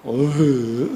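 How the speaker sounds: low slow drawn-out sigh; long weary sigh. Shown as a demonstration. Pastor showing a slow sigh instead of a bark, then laughing. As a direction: let the anger out as a sigh. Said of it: "어떻게?".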